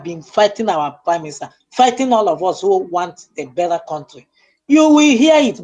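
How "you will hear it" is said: fiery revolutionary speech. Real, not acted. Warning opponents in a fiery speech.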